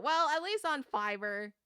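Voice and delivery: normal talking voice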